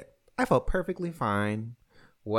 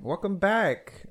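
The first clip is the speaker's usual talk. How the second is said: drawn out greeting; 10 semitones higher, 4 dB louder, slower than usual. Acted.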